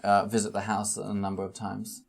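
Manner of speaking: ordinary delivery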